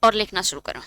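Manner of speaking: ordinary talk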